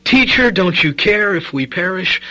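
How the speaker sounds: loud, high, panicked plea; fearful cry. Retold or imitated. Disciples to sleeping Jesus, loud, frightened plea. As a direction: cry out as if the boat is sinking. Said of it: "overwhelmed with fear and anxiety".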